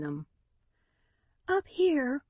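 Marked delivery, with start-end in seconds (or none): 1.5-2.2 breathless high call; out of breath from running; panting. A narrator's reading. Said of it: "panted Lucille".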